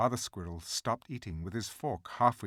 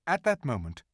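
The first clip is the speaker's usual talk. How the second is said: usual speaking voice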